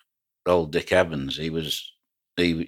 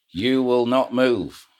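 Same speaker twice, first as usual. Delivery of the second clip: slow, higher, loud announcement over a tannoy; booming tannoy order; 7 semitones higher, 4 dB louder, slower than usual. Retold or imitated. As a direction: boom it out like a loudhailer across the water. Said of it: "he came over this tannoy".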